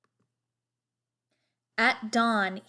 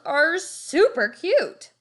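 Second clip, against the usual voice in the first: high, loud, slow gush; clowns gushing; 6 semitones higher, 6 dB louder, slower than usual. A narrator's reading. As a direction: gush over it like a fan. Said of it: "said the clowns".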